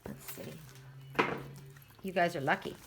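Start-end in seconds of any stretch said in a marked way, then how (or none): 0.1-1.2 drops to a near whisper while she shuffles the deck; softly thinking aloud, barely voiced; hushed muttering to herself. Real, not acted. Reader choosing a card from another deck, murmuring under her breath.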